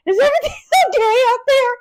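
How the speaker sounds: put-on high, fast, laughing call of a worried old lady. Blind roommate calling from the corner, high laughing call. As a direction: squeak it out quickly through laughter.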